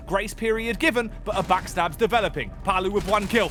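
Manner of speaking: rising, fast play-by-play to a peak; esports caster. Real, not acted. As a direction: build the hype to the clutch shot. Live play-by-play building to clutch.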